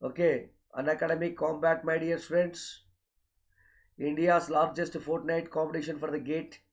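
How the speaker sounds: ordinary talk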